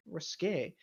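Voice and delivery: ordinary talk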